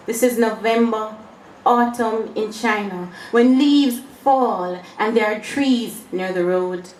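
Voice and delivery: deliberate verse reading with weighted pauses; poetry recital. Acted. Poet reads of an old man raking leaves, poetry recital. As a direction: recite reflectively, letting each phrase land.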